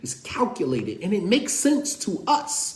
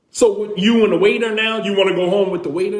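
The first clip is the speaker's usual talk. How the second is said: fast, louder accusing jab; jealous wife; 6 dB louder, faster than usual. Retold or imitated. Wife accusing husband over the waitress, fast jealous jab. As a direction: rattle it off like a suspicious wife. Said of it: "your wife is looking at you like".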